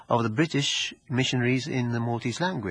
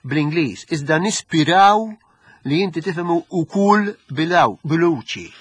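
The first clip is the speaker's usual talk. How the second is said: Arp dialect; 5 semitones higher, 6 dB louder than usual. Retold or imitated. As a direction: read it out in thick Gozo dialect. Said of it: "this letter is written in the Arp dialect".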